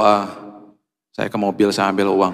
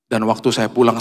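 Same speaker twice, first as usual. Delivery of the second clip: ordinary talk, nothing marked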